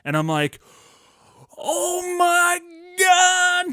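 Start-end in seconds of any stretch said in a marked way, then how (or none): 1.6-3.7 much higher and louder, an ecstatic yell; excited shout. Retold or imitated. Kid sees the He-Man sword from dad, ecstatic shout. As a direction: scream it like a kid seeing his dream toy. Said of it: "I was so excited".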